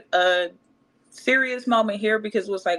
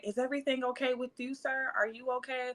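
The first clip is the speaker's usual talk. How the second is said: soft, higher, quick mock-caring mom voice; mom's sweet concern; 4 semitones higher, 9 dB quieter than usual. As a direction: coo over him like a fussy nurse. Mom fussing over the driver.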